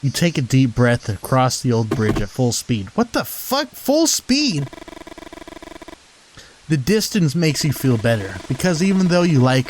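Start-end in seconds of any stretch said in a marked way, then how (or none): 3.0-4.7 much higher, disbelieving outburst; incredulous. Real, not acted. Player balking at the narration, high incredulous outburst. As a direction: balk at the absurd line.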